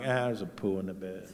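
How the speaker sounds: low, soft, unbothered acceptance; resigned. Retold or imitated. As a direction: drop the voice, as if long used to it. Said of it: "Now I'm like".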